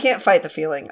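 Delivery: ordinary talk, nothing marked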